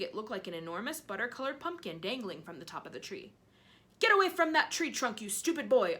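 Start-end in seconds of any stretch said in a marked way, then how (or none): 4.0-6.0 put-on loud scolding yell at the boy; aunt yelling at nephew. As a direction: bark it at the stupid boy. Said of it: "Aunt Spiker yelled".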